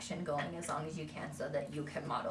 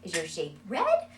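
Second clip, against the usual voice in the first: higher, sing-song run of questions; quizzing child; 5 semitones higher, 4 dB louder than usual. Shown as a demonstration. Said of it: "If we're constantly like".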